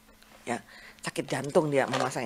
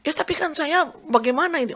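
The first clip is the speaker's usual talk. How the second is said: much higher, plaintive retold protest; retold fretting; 11 semitones higher, 4 dB louder than usual. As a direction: imitate a helpless patient fretting, high-pitched. Patient frets about having no clothes.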